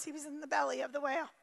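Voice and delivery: polite, soft and quick, the nice way to say belly; tidy it up, quietly and fast, like saying belly in church; the nice Sunday-school version of belly, soft and quick. Shown as a demonstration. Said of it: "We say it nice".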